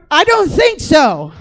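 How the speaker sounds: loud, slow, drawn-out no; slow alarmed refusal. Retold or imitated. Refusing the staff's reassurance, slow and alarmed. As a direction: dig in, scared and stubborn.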